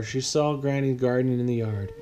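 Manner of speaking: ordinary delivery, nothing marked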